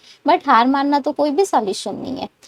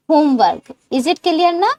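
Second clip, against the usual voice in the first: ordinary delivery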